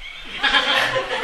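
breathy high laugh without voice; airy laugh. Real, not acted. Monk amused by the hidden microphone, airy laugh. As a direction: let out a light, airy laugh.

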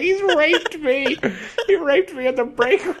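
high, hammed-up outcry; mock accuser. Ripped-shirt worker accusing to the boss, mock outcry. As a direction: stretch the accusation high, near laughter.

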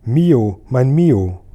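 loud, longing call of a father. A narrator's reading. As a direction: call after him across the garden. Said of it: "rief mein Vater".

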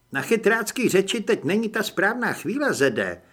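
lightly voiced woman cutting someone short; female character's rebuke. A narrator's reading. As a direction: pitch it up as her reproach. Holly rebukes Zed in narration.

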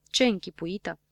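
ordinary delivery

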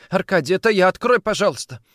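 high, loud, overwrought begging; near-hysterical plea. A narrator's reading. Woman at lover's locked door, near-hysterical. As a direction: cry out, frantic to be let in. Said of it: "звучал надрывно, почти истерично".

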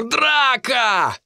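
high, drawn-out rallying yell; excited schoolboy holler; gleeful Cooper. A narrator's reading. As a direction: cry it out with delight, stretching the vowel. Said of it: "воскликнул счастливый Купер".

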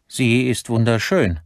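high, emphatic admiration; son's high-pitched insistence; admiring protest. A narrator's reading. Son defends the watch to father.

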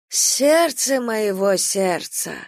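slow, half-strangled tender voice; half-choked. Acted. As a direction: speak while hugged tight. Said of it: "полузадушенно говорит бабушка".

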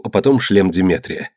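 plain reading voice

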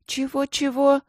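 высокое девчачье переспрашивание; переспроси задиристо, высоким голосом; с вызовом. A narrator's reading. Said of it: "с вызовом спросила Майка". (дерзкий высокий вопрос)

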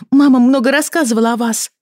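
put-on ringing girl's voice. A narrator's reading. Lili telling the father what mama said, high and ringing. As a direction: pipe up like an eager girl.

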